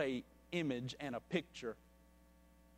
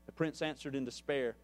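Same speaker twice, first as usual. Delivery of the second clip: measured storyteller reading a parable to the congregation; story narration; 3 semitones higher, 7 dB louder than usual. A narrator's reading. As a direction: tell the tale steadily, letting the quotes land. Reads a parable aloud.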